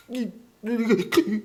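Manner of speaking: high, drawn-out crying hiccups; hiccuping sobs. Retold or imitated. Peter before Jesus, snotty hiccuping sobs. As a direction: stutter through tears. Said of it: "눈물 콧물 막 이러면서".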